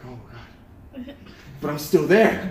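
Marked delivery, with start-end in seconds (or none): 0.1-0.4 barely audible, low mutter; quiet retold dismay; hushed dread. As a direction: breathe it out as if all is lost. Retold dread when time ends.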